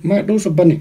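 barely intelligible, cheeks stuffed; mouth full. Real, not acted. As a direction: force the words out round a full mouth. Chubby Bunny game, mouth stuffed.

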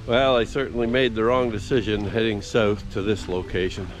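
normal talking voice

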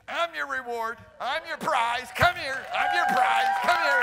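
a father's coaxing call swelling to a loud high shout; building joyful shout. Retold or imitated. Father calling his child home, building to a shout. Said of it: "Father's just going".

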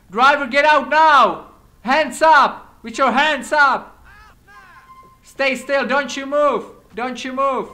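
loud, high commands barked at a driver; shouted police commands. Acted. Officer to a stopped robbery driver, loud commanding shout. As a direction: yell orders like a cop at a traffic stop. Officer calling out at a felony stop.

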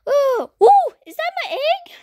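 high, delighted squealing bird; excited squeal. Pretend. Bird discovering its own egg, excited squeal.

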